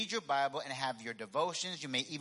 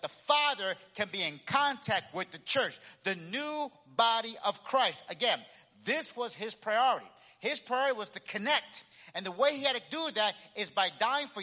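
raised, driving sermon delivery; emphatic preaching; 6 semitones higher, 4 dB louder than usual. Acted. Preacher explaining Christ's priority to the church, emphatic and raised. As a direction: press the point with rising conviction.

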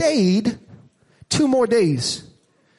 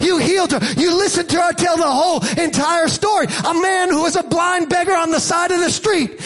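raised, pounding sermon voice voicing Mary and Martha; fiery preaching; 7 semitones higher than usual. Preacher voicing the grieving sisters to the congregation, fiery preaching. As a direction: preach it hard, building to a cry.

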